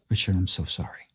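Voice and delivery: low, soft and grave, quietly breaking bad news; somber apology. Manager on phone breaking news of death, low and grave. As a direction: hush it like delivering a death.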